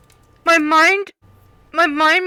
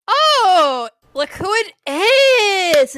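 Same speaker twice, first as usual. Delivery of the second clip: loud, high, drawn-out hail; excited greeting; 7 semitones higher, 7 dB louder, much slower than usual. Acted. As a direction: call out with big delight. Player calls out a greeting.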